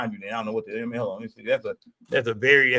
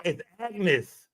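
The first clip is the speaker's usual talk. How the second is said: ordinary delivery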